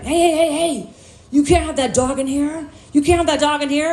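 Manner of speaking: mock yell from an agitated clerk; imitated yelling. Store clerk to customer with a dog, yelling. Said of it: "starts yelling".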